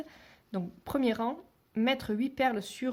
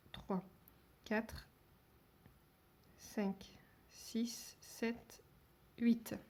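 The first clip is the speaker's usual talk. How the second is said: counting under breath; 10 dB quieter, much slower than usual. Real, not acted. Counting beads while threading.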